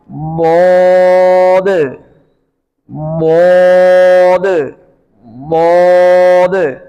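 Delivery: बोद with a full rounded vowel, drawn out; slow recitation of बोद with echoing final d; heavy, long-held daad. Shown as a demonstration. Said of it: "इसी तरह". (बोद को मोटा करके खींचकर)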